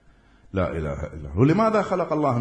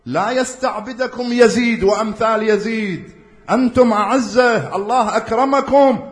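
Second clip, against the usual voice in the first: high, loud, slow oratory voicing the imam; preaching at full force; 9 semitones higher, 6 dB louder than usual. Preacher voicing Hussain addressing enemies, high, loud and slow. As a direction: declaim it like a battlefield address.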